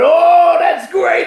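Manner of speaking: high, loud gleeful outburst; imitated delight. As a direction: crow it as the thrilled reporter. Reporter delighted by the comeback.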